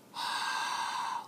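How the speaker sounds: sharp breath pushed up from the belly; forceful breath push. Shown as a demonstration. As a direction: push the air out hard, like fogging glasses. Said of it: "like that".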